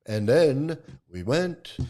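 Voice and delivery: slow, drawn-out parody of preacher cadence; mock trance talk. Mocking a holy man's put-on speech, trance talk. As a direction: imitate a guru's slow trance delivery. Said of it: "trance talk, talking like he's a friggin'".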